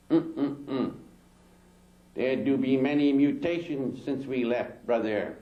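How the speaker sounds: slow, put-on voice of the little African cosmonaut; alien cosmonaut's drawl. Alien leader answering Dick Gregory, slow put-on drawl. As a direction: drag the words out, dialect-heavy.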